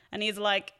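ordinary talk, nothing marked

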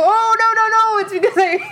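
high, loud re-enacted backpedal; flustered, giddy mock protest; excited panic. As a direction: blurt it high, half laughing. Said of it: "I got so excited".

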